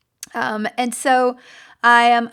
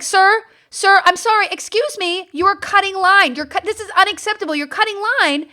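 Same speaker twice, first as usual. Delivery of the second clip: raised, high-pitched polite objection; firm, indignant protest; 6 semitones higher, 3 dB louder than usual. Retold or imitated. Confronting a man cutting the taxi line, high indignant voice. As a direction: call out a line-cutter, insistent and affronted. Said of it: "And I do it in that kind of voice".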